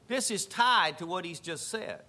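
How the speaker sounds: ordinary talk, nothing marked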